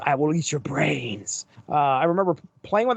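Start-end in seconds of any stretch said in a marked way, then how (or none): 0.1-1.5 mock monster toy voice, a touch lower; slightly put-on villain catchphrase; Venom action figure. Recalling a Marvel toy's button-press saying, mock villain voice. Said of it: "his saying was".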